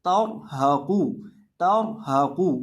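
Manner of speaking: तौहाकू with a thick ra rounding the first syllable, as "tawr"; heavy ra. Shown as a demonstration. Teacher applying the rule, heavy ra in तौहाकू. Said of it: "रॉ को यहाँ मोटा करके पढ़ेंगे".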